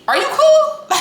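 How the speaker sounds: high, loud squeaky mock question; mock squeal. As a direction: squeak it out in disbelief at her.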